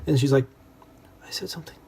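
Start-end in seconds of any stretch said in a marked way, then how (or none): 1.2-1.8 ashamed voiceless whisper. Retold or imitated. Daughter confesses to her mother in a guilty whisper. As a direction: breathe it out as a guilty secret.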